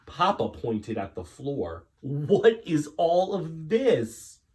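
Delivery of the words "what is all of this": loud, slow, high incredulous; stern demand. Acted. Papa pointing at the floor, incredulous demand. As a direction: demand it, aghast.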